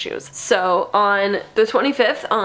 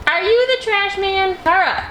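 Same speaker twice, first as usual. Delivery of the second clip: high teasing coo at the cat; 10 semitones higher, 3 dB louder than usual. Real, not acted. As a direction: tease the kitty in a squeaky voice.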